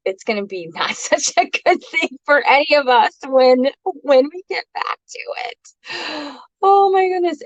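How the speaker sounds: high laughing voice. Real, not acted. Laughs about unkempt brows.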